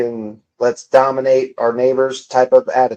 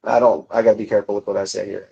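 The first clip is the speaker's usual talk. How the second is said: ordinary talk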